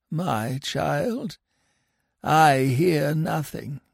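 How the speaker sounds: put-on a sly old mugger crocodile. A narrator's reading. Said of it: "said the Mugger".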